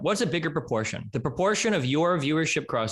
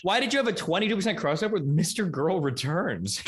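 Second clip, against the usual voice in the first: ordinary delivery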